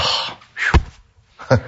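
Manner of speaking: unvoiced exhale of relief; relieved sigh. Pastor acting out relief to a worried friend, sighing. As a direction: let out a big whew. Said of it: "So now he's even relieved".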